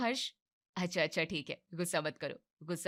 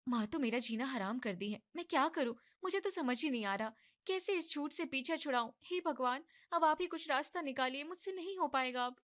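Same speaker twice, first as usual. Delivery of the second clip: higher, softer worried voice; fretting young wife; 7 semitones higher, 3 dB quieter than usual. Acted. Pregnant-pretending wife frets to God, higher and soft. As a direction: pray for a way out, quietly desperate. Daughter-in-law frets alone.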